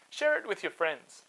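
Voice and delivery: ordinary delivery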